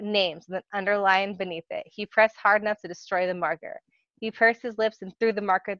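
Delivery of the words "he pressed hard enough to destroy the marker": usual speaking voice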